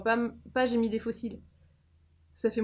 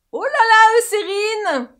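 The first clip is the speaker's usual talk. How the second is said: high, loud exclamation; whooping, high-pitched praise; excited cheer; 9 semitones higher, 8 dB louder than usual. Pretend. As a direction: cheer the brand on loudly. Mock-cheering the sunscreen passing test.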